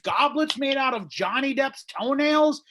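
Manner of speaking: high, loud craft-beer snob voice; snobby bartender. Retold or imitated. Comic mocks a hipster bartender's pitch, snooty high voice. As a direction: oversell it like a pompous brewer. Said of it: "selling me on this, like".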